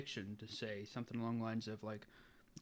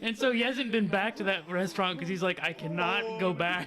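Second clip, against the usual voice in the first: louder, higher laughing speech; 7 semitones higher, 8 dB louder than usual. Real, not acted. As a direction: talk through the laughter. Still laughing after punchline.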